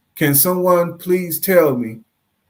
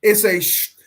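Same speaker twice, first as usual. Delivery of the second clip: usual speaking voice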